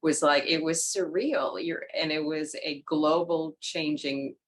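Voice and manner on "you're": usual speaking voice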